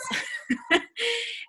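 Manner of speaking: a sudden high-pitched laugh. Real, not acted. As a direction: burst into a high giggle. Laughing at the cancelled account.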